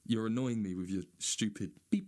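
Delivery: ordinary talk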